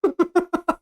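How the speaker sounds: high, taunting giggle; high villainous titter; villain's laugh. Acted. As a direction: laugh mockingly at the hero, pitched high.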